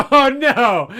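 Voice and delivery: high burst of laughter; cracking up loudly; laughing. Real, not acted.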